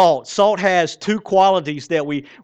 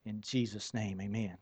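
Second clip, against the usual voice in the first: hushed, reverent closing prayer; quiet prayer; 6 semitones lower, 11 dB quieter than usual. Real, not acted. Pastor closing his prayer before the sermon, hushed and reverent. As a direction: pray softly to end.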